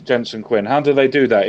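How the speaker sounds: normal talking voice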